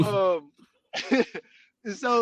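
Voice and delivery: ordinary talk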